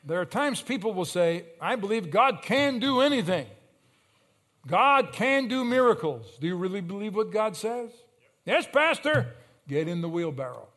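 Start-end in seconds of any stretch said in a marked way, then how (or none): 1.6-6.4 loud, high preacher's proclamation; fervent declaration. Acted. Voicing people declaring bold faith. 8.4-9.4 high, loud mock-eager shout; eager congregation reply.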